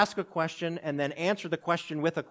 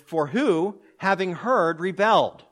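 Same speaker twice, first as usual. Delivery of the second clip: louder, building question with stress on "who"; dramatic question; 4 semitones higher, 7 dB louder, slower than usual. Acted. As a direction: demand it from the crowd, then drop on "rebelled". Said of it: "He starts with".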